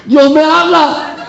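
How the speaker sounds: high, slow, laughing "Dios me habla"; awed reply. Retold or imitated. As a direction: say it high and wondering through a laugh. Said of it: "Le digo".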